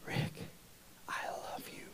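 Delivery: very soft near-whisper; faint, haunting whisper; hushed inner voice. Retold or imitated. Hears a voice during deals.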